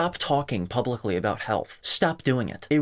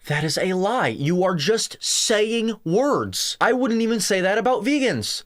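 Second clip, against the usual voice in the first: very slow, higher and drawn out in disbelief; exasperated; 8 semitones higher than usual. Real, not acted. Fed up with the critic, drawing out each word slowly and higher. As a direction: stretch every word like you can't believe it.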